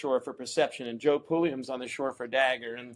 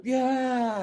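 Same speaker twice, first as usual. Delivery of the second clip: much higher, thrilled agreement; hyped yes; 10 semitones higher than usual. Retold or imitated. Owner answering the paddler amid the cheering, thrilled high yes. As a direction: blurt it out carried away.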